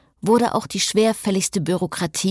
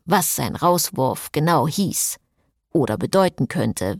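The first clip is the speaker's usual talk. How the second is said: ordinary delivery, nothing marked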